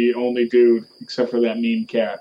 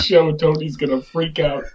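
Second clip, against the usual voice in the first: usual speaking voice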